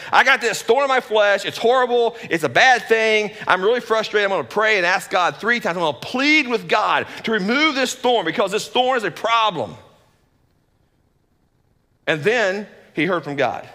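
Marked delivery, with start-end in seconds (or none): 0.1-9.8 higher, louder frustrated Paul; a preacher voicing Paul's frustrated pleading; frustrated complainer. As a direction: whine it out like a man fed up with his pain. Paul's complaint voiced in sermon.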